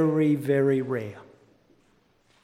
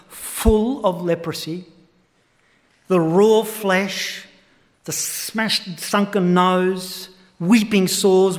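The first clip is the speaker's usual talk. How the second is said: normal talking voice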